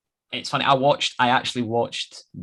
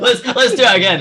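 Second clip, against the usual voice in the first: high, loud eager burst; eager yes man; 13 semitones higher, 8 dB louder than usual. Acted. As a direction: jump in keenly, like a yes man. Mock eager call to repeat.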